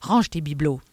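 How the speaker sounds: abrupt, firm order; brusque. A narrator's reading. Inspector orders the clutter put away, brusque. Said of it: "dit brusquement Adamsberg".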